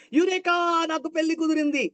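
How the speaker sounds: high, bright joyful cry; overjoyed. Shown as a demonstration. Teacher reads the engagement line with great joy. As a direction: burst out with delight at the news. Emotion drills read aloud.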